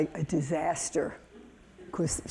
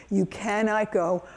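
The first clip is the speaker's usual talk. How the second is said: usual speaking voice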